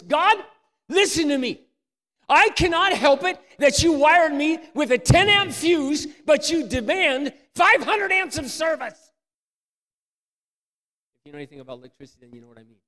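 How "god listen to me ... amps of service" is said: loud, high, slow pleading yell; desperate shout at God. Retold or imitated. Young husband crying out to God in frustration, shouting. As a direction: shout your frustration up to heaven. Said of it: "telling God something like this".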